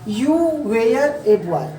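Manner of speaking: Indian-style English, "were" as way-yar, "a" as ay; slow Indian-style reading, every word full; Indian phonetic. Shown as a demonstration. Teacher recalls own reading for students, Indian phonetic demo. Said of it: "कैसे पढ़ा Indian phonetic में".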